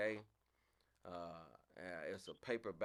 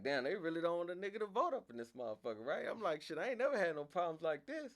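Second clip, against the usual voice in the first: high, loud indignant rant; 9 semitones higher, 5 dB louder than usual. Retold or imitated. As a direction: vent to the clerk in outrage. Indignant at clerk.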